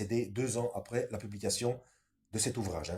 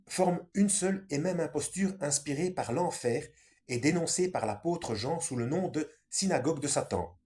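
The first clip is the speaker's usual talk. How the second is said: raised, declaiming book reading; reading aloud; 4 semitones higher than usual. Acted. Reading the book's conclusion to viewers, raised declaiming voice. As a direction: declaim the passage a little higher.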